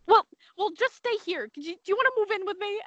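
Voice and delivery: high, fast mock old-lady pleading; a hammed-up grandma begging them to stay home; imitating grandma. Teasing her grandma's worry, high and fast mock old-lady voice. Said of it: "And she goes".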